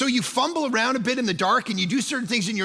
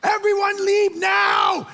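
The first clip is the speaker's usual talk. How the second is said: high, slow, drawn-out yell; acted-out full-voiced panic shout; panicked yell; 11 semitones higher, 5 dB louder, much slower than usual. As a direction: yell the order across a room in alarm. Retold panicked order to kids.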